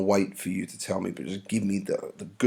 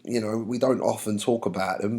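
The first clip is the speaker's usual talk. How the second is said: ordinary delivery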